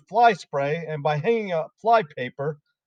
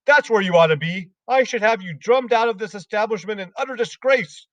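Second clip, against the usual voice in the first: shouting; 10 semitones higher than usual. Acted. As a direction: spit each insult out. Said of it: "the Trunchbull was shouting".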